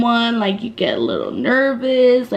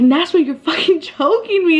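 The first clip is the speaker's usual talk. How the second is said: mildly hammed-up complaint, pitched up; exasperated protest; 6 semitones higher than usual. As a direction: retell an exasperated plea to a friend. Acted complaint to clinging friend.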